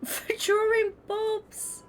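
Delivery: squeaky laugh through the words; slow, high, giggling aside; giggled out slowly. Real, not acted. Laughing at the misreading.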